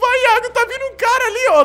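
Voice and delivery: being chased. Real, not acted. Warning friend, killer approaching.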